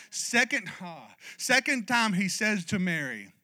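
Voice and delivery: normal talking voice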